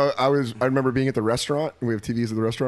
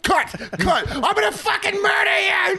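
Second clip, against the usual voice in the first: mock robber yell. Friend joining robber bit, mock yelling threats. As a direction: yell it like a crazed gunman.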